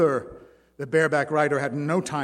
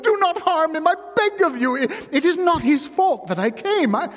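desperate pleading; 14 semitones higher, 7 dB louder than usual. A narrator's reading. Wife begs her husband to spare Simon, pleading. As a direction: cry out for mercy. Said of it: "Jeanne-Marie implored".

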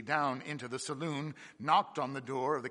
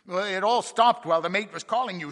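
eager report; 6 semitones higher, 10 dB louder than usual. Acted. Second mate reports to captain.

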